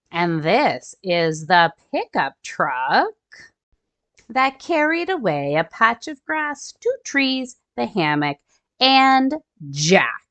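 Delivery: storybook read-aloud for little ones; gentle picture-book narration; reading to children. A narrator's reading. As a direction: read it like story time for kids.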